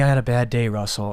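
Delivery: ordinary delivery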